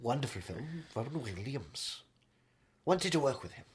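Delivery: low, soft, rambling luvvie; wistful veteran actor. Acted. Actor reminiscing about his film co-stars, low and soft. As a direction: murmur fond praise like an old thespian.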